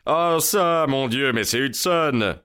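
high-pitched cry of astonished recognition; surprised exclamation. Acted. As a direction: exclaim as if suddenly recognizing someone. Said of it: "s'écria monsieur Trevor avec une intonation de surprise".

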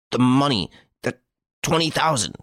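high, halting stammer. A narrator's reading. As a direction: stammer it out, beside yourself. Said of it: "he stammered".

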